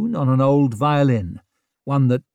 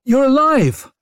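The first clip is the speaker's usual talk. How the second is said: excited; 12 semitones higher, 7 dB louder than usual. A narrator's reading. Brock greets survivor.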